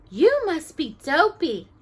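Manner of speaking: high, slow delighted voice; high slow happy princess voice; glad princess. A narrator's reading.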